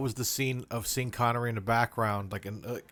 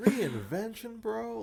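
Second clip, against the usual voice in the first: high, laughing disbelief; incredulous; 12 semitones higher, slower than usual. Real, not acted.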